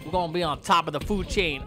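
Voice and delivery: usual speaking voice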